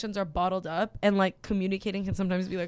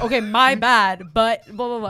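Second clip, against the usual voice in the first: slow, emphatic mock apology; mock passive apology; 8 dB louder, slower than usual. Mimicking a guy's half-hearted apology, mock passive. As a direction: toss out a loud, dismissive non-apology. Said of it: "that's so passive".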